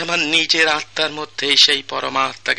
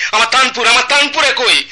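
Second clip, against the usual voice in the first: frantic call; 9 semitones higher, 3 dB louder than usual. Acted. Master frantically calls for tanpura.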